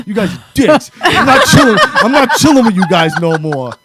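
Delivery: high, loud mock outrage from a fed-up friend; retold angry yelling. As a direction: yell the fed-up protest, half laughing. Retold friend protesting angrily.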